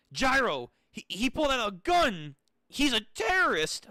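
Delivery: high, loud, rising alarm; alarmed cry. Acted. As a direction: warn your partner in a mock panic. Acts out Johnny's alarm at gun.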